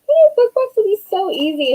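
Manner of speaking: high-pitched mock breezy confidence; naive optimism. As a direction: parody your own easy-going optimism. Mocks her earlier naive optimism.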